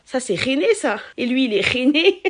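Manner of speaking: put-on plus fort, accent du Sud assumé; répète « rené » à la marseillaise; Marseille accent. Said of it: "j'arrêtais pas de dire". (accent marseillais)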